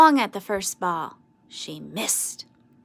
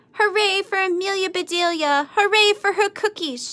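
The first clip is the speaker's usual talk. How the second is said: high, loud happy cheer; 9 semitones higher, 4 dB louder than usual. A narrator's reading. Boys cheering Amelia Bedelia's cookies, high and loud. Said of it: "shouted the boys".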